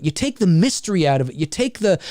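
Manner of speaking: normal talking voice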